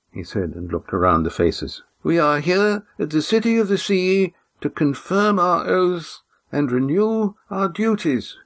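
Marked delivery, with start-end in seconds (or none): 2.0-8.4 put-on formal old man. A narrator's reading. Old Oron welcomes the Silver Swan, formal. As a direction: greet her like a stiff elder.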